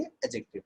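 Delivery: एडजेक्टिव with silent D, long ae then jektiv; correct pronunciation. Shown as a demonstration. Teacher repeating the right form, D silent. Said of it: "डी silent करके बोलना".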